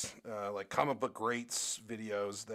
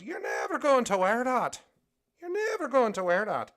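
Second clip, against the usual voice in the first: high, loud Irish husband's outburst; Irish accent; 13 semitones higher, 10 dB louder than usual. Retold or imitated. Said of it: "husband was Irish".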